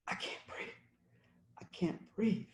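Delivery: breathless whisper of "breathe". Acted. Poet voicing Eric Garner's last words, whispered. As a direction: let the voice almost vanish. Repeated line fading to whisper.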